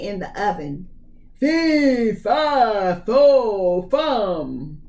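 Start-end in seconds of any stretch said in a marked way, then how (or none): 1.4-4.8 booming giant's chant, each word pounded out; ogre roar. Acted. Ogre bellowing his chant as he stomps home. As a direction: stomp out fee fi fo fum like a giant.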